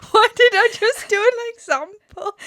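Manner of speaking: high squeal of laughter; laughing. Real, not acted. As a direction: laugh through the words. Laughing at own blunder.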